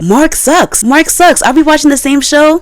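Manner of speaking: high, emphatic rant; exasperated. Real, not acted. Vlogger defends Amber to fans, high exasperated rant. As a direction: hammer it home in disbelief.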